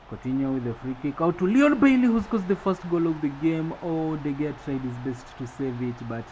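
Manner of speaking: loud, high, excited goal call; live play-by-play. Acted. Commentator calls Leon Bailey's opening goal, excited play-by-play.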